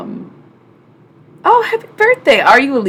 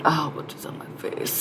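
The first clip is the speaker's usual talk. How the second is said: Nico; 9 dB quieter than usual. Acted. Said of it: "Nico woke up. She said".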